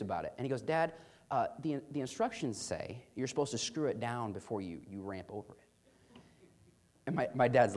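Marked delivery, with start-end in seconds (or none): 0.6-6.2 put-on much quieter, earnest kid warning his dad; dead-serious, low-key caution; serious little brother. Brother warning dad about the instructions, quiet and earnest. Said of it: "when he's serious".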